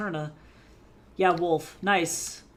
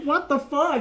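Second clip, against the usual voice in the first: startled outburst; 8 semitones higher, 8 dB louder than usual. Retold or imitated. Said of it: "And he's like".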